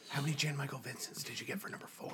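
whispered, furtive question; hushed stage whisper, played for laughs; mock secret whisper. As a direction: whisper it as if sneaking a quiz answer. Jokingly whispering a quiz question to a neighbor.

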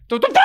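tooted trumpet notes; vocal horn fanfare; trumpet imitation. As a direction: mimic a street trumpet with the mouth. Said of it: "con una trompeta y empieza".

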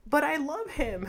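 shocked protest. Retold or imitated. The dad's widow, stunned at the funeral, mock shocked and high. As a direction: squeak it out like she can't believe it. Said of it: "I remember her being shocked".

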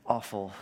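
"awful" set apart after a pause, low and soft; emphasized word. Real, not acted. Preacher underlining the church's power, "awful" low and weighty. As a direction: drop "awful" down low and let it sit alone. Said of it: "I do emphasize the word".

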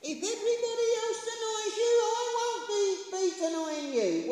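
high-pitched, hurried boast; big-mouthed Peter. Acted. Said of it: "Mister Big Mouth, Simon Peter".

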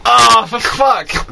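slow, high mock curse as he slips; mock exasperated cry. Friend mimics the cyclist slipping, mock curse. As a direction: drag out the curse, hammed-up.

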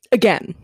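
Again said as its own line, landing firm and falling; stress on again. Real, not acted. To listeners about a repeat impeachment, Again stressed hard. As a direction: lean on the word Again to show disbelief. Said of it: "Emphasis on again".

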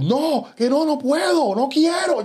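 panicked pleading. Retold or imitated. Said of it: "Empecé a llorar".

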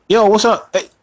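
high, loud hail; shouted greeting. Acted. Host hailing distant listeners, high loud greeting. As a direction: yell a hello to people far off.

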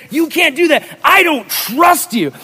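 high, loud, drawn-out fury, imitated; angry shouting. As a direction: shout like an outraged neighbor. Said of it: "He got angry".

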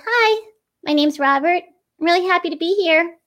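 inflected puppet voice. Shown as a demonstration. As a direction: lift the pitch and let the phrases flow. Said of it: "add these little inflections and moments".

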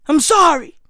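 usual speaking voice

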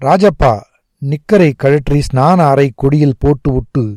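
plain reading voice

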